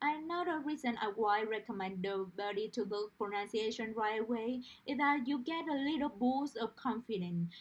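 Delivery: ordinary talk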